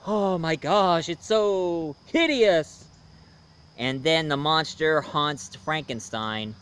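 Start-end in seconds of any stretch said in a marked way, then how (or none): 0.1-2.8 slow, drawn-out theatrical dismay; hammed-up horror, stretching the final word high; mock horrified exclamation. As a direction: play Frankenstein recoiling from his creation, overdone. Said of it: "he was like".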